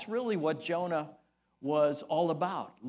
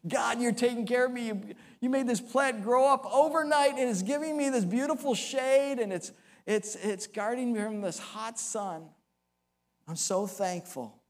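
higher, fast, thrilled Jonah thanking God; gushing thanks; 5 semitones higher than usual. Acted. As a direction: gush out your gratitude, quick and high. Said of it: "he just saw this as a tremendous blessing".

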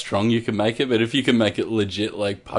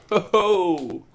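mock hoot; 10 semitones higher, 4 dB louder than usual. Jokey exclamation after pun.

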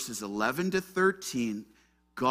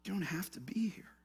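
soft, high, whispery reassurance; not condemning; 6 semitones higher, 7 dB quieter than usual. Acted. God reassures Elijah in the cave, hushed and uncondemning. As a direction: release them gently, almost whispered. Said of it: "It's not a condemning one".

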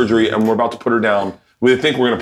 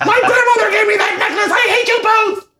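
very high, wailing mock sobs; hammed-up tearful shriek; mock crying. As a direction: imitate someone bawling in outrage. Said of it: "This story ends with me crying".

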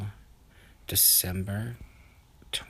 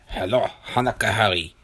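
Alexei the pilot; 2 semitones higher, 7 dB louder than usual. Acted. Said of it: "the voice on the other side said".

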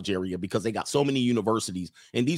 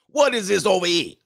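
higher-pitched, r-less Boston griping; mock Boston accent; 7 semitones higher than usual. Host mimicking pissed-off Boston locals, Boston accent.

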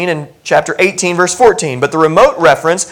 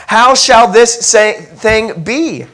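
Mary asking; 8 semitones higher, 5 dB louder, much slower than usual. Retold or imitated. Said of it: "she asked".

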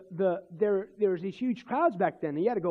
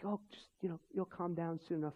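much softer, lower imitation of naysayers; retold soft, patronizing reassurance; quiet, dismissive advice; 4 semitones lower, 13 dB quieter than usual. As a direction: play down their excitement in a hushed voice.

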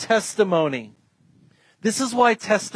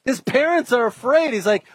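ordinary talk

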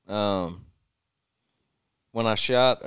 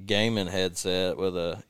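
usual speaking voice

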